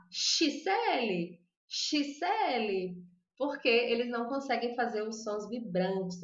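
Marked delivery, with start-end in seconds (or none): 0.1-3.0 Xisele said slowly with a sh sound at the start, no zh; drawn-out Xisele, first sound sh as Spanish speakers would say it; Spanish-style Xisele. Shown as a demonstration. Teacher shows how Spanish speakers say the name, Xisele with a sh. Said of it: "A pessoa que fala espanhol vai falar".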